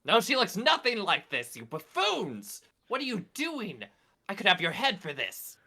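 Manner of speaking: high, loud scolding; imperious outrage. Acted. Esther scolds men holding the wrong girl, outraged. Acted noble berates buffoons.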